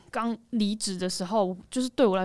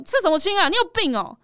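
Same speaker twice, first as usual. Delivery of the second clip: loud, high retold scolding; re-enacted outburst, raised voice; angry scolding parent; 10 semitones higher, 4 dB louder than usual. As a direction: imitate an indignant parent telling off. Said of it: "我爸那时候很生气".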